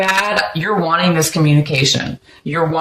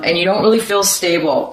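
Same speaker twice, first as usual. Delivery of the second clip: ordinary talk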